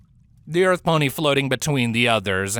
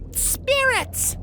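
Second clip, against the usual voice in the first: high called-out summons; a high ringing call to the spirits; cried out. Acted. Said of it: "she cried out".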